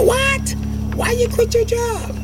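high, shocked mother's voice. Retold or imitated. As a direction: squeak out a startled mom. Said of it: "She said".

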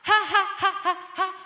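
high, loud fake ha-ha; forced laugh. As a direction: push out a laugh that won't come. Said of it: "But I can't laugh".

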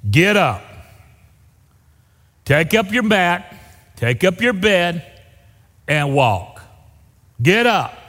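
loud, firm command, slow on take up your mat. Retold or imitated. Jesus commanding the lame man to rise, loud and firm. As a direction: order him up with authority. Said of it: "I think probably in that same tone of voice".

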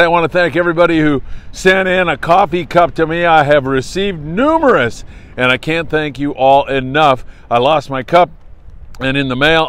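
ordinary talk

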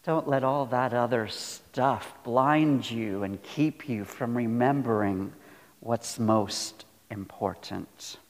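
ordinary delivery

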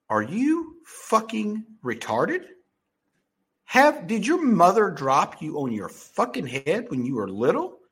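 retold tirade, drawn-out incredulous questions; exasperated disbelief. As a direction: spell it out slowly, as if to someone dense. Acting out his rant at agent.

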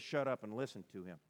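normal talking voice

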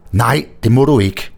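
a lightly voiced desperate protest; desperate plea. A narrator's reading. Narrator begs Lise not to stop, desperate. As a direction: plead against her stopping. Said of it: "nærmest helt desperat".

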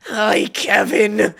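slow, drawn-out swooning hello; gooey mock-starstruck greeting; fawning. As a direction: greet your idol, utterly smitten. Mocks own fawning greeting to idol.

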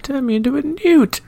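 high, put-on peasant complaint; Monty Python peasant voice. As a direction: whine it like an indignant villager. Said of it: "Monty Python".

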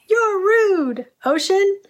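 daughter's high-pitched indignant cry; mock yell, pitched well up, like an offended child; imitated yell. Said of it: "yelled out".

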